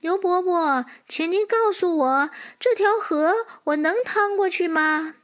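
high, polite foal voice; little horse asking. Acted. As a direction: ask an elder politely in a high young voice. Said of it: "小马哒哒哒跑过去， 问道". (小马用高高的童声礼貌地请教)

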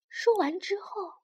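plain reading voice

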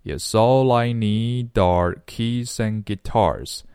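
"yes, all I" slowly run together; slow, joined-up "yes, all I need are keys and guitars"; linked speech. Shown as a demonstration. Teacher shows students linking, slow and joined from "yes" to "guitars". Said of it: "这里要全部连起来".

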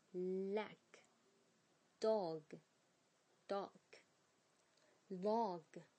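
usual speaking voice

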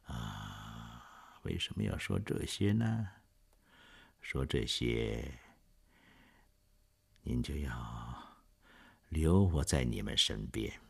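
soft, slow and weary; too weak to argue; frail murmur. Acted. Dying Jean Valjean to the couple, frail soft murmur.

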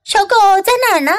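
quick, high-pitched question; worried cry. A narrator's reading. Kids look for the missing puppy, quick cry. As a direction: call out fast for a lost puppy. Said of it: "孩子们叫道".

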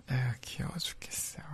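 breathy laughing whisper, high; adoring whisper. Acted. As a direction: sigh and whisper how cute she is. Whisper over her crying.

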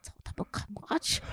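whispered curse; inner thought. Retold or imitated. Wife swearing to herself, whispered. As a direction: hiss the curse under the breath. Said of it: "我心说".

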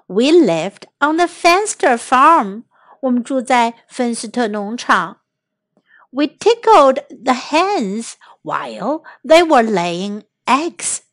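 usual speaking voice

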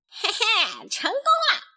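very high, squealed triumph with a snicker; gleeful villain. A narrator's reading. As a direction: crow over the successful trick. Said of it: "黄鼠狼边往回跑边说". (得意地尖声窃喜)

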